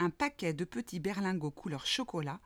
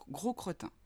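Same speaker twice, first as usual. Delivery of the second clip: ordinary delivery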